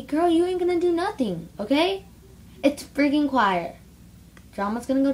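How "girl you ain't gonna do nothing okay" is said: high, loud sassy comeback; sassy retort. Retold or imitated. Teen vlogger mocking the girls, sassy loud comeback. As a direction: fire it back with attitude. Retold line defying those girls.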